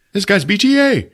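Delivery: high henchman call; high goon voice. Retold or imitated. Repeat of guard line.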